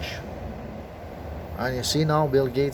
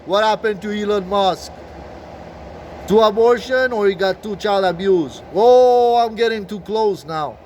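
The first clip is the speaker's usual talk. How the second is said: high, loud, drawn-out whoa; loud mock astonishment; 6 semitones higher, 6 dB louder, slower than usual. Vlogger reacting to his own discovery, loudly astonished. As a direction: exclaim it like a shocking discovery.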